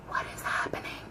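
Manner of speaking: barely-there bewildered whisper; stunned whisper. Retold or imitated. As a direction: whisper it in disbelief. Acts out own stunned confusion.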